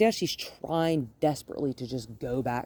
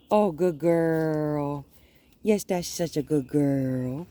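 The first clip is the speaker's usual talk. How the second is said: slow, drawn-out praise for a good dog; sweet dog praise; much slower than usual. Real, not acted. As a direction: coo it slowly to the dog.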